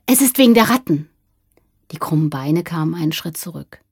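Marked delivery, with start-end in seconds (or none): 0.1-1.1 fast, high blurt; quick, raised-voice confession; hurried. A narrator's reading. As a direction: rush out the secret. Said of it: "sagte Jürgen da schnell".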